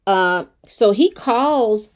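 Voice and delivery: ordinary talk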